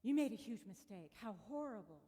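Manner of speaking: the mind scolding itself; critical inner voice. Retold or imitated. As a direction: play the mind's harsh critic. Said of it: "It will just say".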